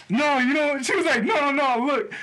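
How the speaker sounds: high mock girl voice; pleading, pitched high; her denial. Imitating his passenger's protest, high and playful. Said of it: "She said".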